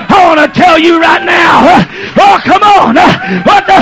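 high, loud hammed-up yelling to calm the crowd; mock usher shouting. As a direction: play the flustered usher shouting at the noisy church. Preacher plays usher hushing crowd.

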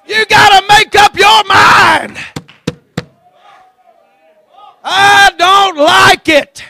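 slow, high shouted declaration; drawn-out, defiant preacher's shout; full-force revival preaching. Acted. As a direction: holler it out word by word to the congregation.

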